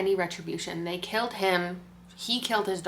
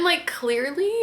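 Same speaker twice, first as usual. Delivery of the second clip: usual speaking voice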